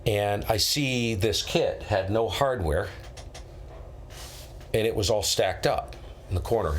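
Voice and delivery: ordinary talk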